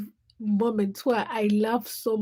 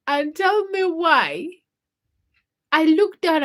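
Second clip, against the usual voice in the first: excited build-up; 6 semitones higher, 8 dB louder than usual. Real, not acted. Building to the punchline.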